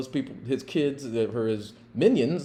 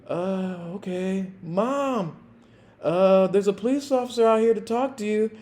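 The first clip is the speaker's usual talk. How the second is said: put-on meek kid; 9 semitones higher than usual. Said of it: "and he's like".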